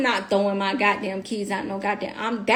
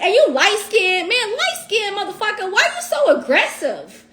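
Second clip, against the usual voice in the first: high, louder mock scolding with a laugh; sassy callout; 9 semitones higher, 3 dB louder than usual. To the aggressive officer, a high laughing mock scolding. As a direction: call him out, half joking, half indignant. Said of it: "And I'm like".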